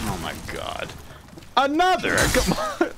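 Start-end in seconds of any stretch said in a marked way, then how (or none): 1.6-2.9 high-pitched cry of disbelief; exasperated cry. Real, not acted. Gamer protesting another setback, high exasperated cry. Game goes wrong again.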